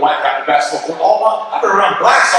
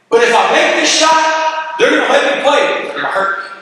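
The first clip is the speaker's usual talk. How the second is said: ordinary talk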